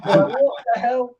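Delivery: high, loud and drawn out through laughter; slow, incredulous mock outburst with a laugh; laughing disbelief. Retelling the players caught out in French, laughing disbelief.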